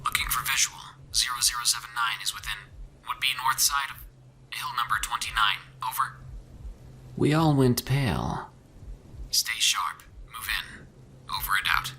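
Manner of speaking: soft whispered radio chatter; like a distant radio transmission; faded military radio. A narrator's reading. Soldiers over a crackling radio, stern and regimented. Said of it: "tone of the man's voice as military".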